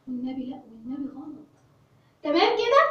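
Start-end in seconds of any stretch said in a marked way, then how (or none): 0.1-1.5 much softer, pleading aside; soft plea. Real, not acted. Teacher softly begging pupils not to misread it. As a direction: beg them gently under the breath. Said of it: "teacher pleading softly".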